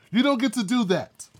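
ordinary delivery

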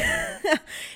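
a short, high-pitched laugh of disbelief; high incredulous laugh. Real, not acted. Vlogger reading cringey royal messages, laughing in disbelief. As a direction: laugh in shocked amusement. Reacting to the quoted chat.

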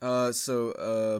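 ordinary talk, nothing marked

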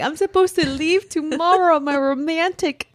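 high mock whine; retold lament. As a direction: whine about your ruined plans. Laments the threatened trip.

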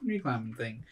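quiet, muffled mumble from afar; muffled kid voice. Acted. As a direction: mumble it like through a wall. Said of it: "hear him muffled saying".

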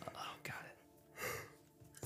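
breathy slow whisper. Retold or imitated. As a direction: whisper it slowly, creepily. Quoting Riddler as a reveal.

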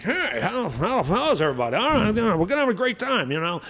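put-on high, excitable old stand-up greeting the crowd; swooping, rushed old-comic impression; Rodney. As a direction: pitch it way up, words tumbling out. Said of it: "Rodney's up on stage. He's like".

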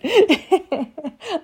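loud high giggle; high laugh. Real, not acted. Laughing at her dog's protest, loud high giggle. After voicing the dog.

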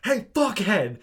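loud, high imitation of an angry yell; retold yell. Rider yelling at the bus driver, loud mock yell. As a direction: yell it out like an irate passenger. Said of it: "he just yells to the bus driver".

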